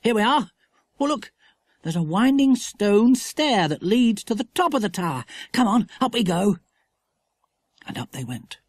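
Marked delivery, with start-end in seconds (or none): 0.0-6.6 put-on high, eager boy; excited boy. A narrator's reading. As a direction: rush them up the stair.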